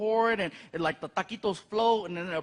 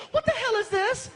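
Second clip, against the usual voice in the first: put-on much higher, indignant girlfriend; shocked girlfriend; 13 semitones higher than usual. Girlfriend in the kitchen at night, in a much higher shocked voice. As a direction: squeal it like a startled girlfriend. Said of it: "she's like".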